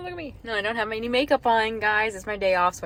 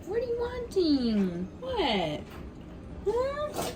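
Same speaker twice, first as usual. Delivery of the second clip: cooing pet talk; 12 semitones higher, 3 dB quieter, much slower than usual. Real, not acted. Owner asking Dudley the dog what he wants, high cooing. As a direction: coo questions to a whining pet.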